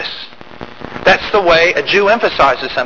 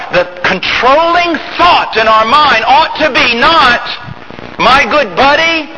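preaching shout; 7 semitones higher than usual. Real, not acted.

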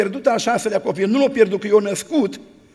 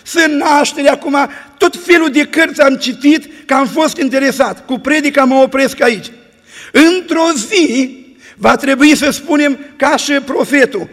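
full-force preaching; 5 dB louder than usual. Acted. Preacher blessing the church at the sermon's end, full-force preaching.

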